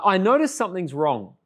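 ordinary delivery

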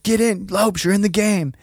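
high-pitched mock coach yell; mad coach's yell. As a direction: imitate an angry football coach ordering a player in. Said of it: "then he gets mad".

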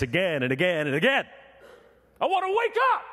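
loud, high cry of longing; passionate shout. Real, not acted. Preacher crying out his longing to the congregation, full shout. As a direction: shout it out to the rafters.

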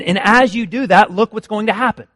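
ordinary delivery

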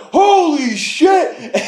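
high, slow, stunned gasp. Retold or imitated. Shoppers watching grandpa fly through the window, stunned. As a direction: drag it out in disbelief. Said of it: "everyone's like".

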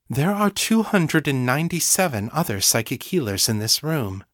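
plain reading voice

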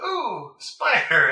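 mock startled. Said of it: "it's like".

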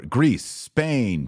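ordinary delivery

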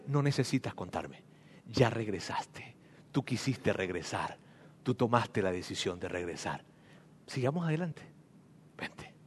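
very soft, low shushing whisper; quiet him gently, nearly breathless; hushing whisper. Acted. Father hushing his penitent son in a soft whisper.

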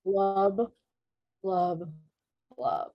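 lightly voiced monotone fish noises; bored fish blubs. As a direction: let each blub deflate like a bored fish. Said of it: "Like, very boring".

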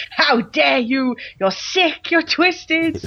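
hammed-up shocked outrage; mock outraged woman. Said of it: "she just went off".

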